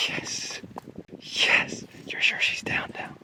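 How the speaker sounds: excited whisper. Real, not acted. Excited whisper checking the shot.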